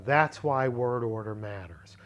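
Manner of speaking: ordinary talk, nothing marked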